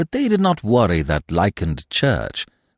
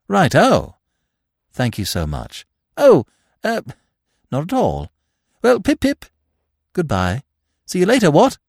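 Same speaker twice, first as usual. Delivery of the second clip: high, breezy upper-class twit; chatty, airy young gentleman; silly young toff; 9 semitones higher, 3 dB louder than usual. A narrator's reading. Freddy takes leave.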